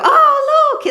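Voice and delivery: loud, high-pitched cooing over a bump; mock gushing. Strangers fussing over a pregnant woman, mock gushing. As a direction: squeal it in exaggerated delight.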